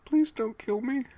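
high, pleading beg for his life; terrified begging. Acted. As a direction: Wayne begging the masked guide, high and terrified. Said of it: "Wayne begged".

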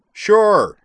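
high, loud one-word holler; yell. A narrator's reading. Zoner agrees from far off after considering, yelling. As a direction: yell agreement across the distance. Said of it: "then yelled".

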